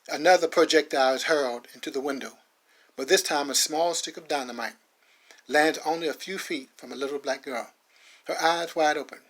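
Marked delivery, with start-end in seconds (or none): none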